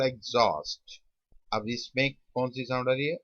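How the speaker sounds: ordinary delivery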